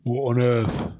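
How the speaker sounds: low British exclamation; British-accented bafflement; mock British accent. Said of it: "in your best British accent".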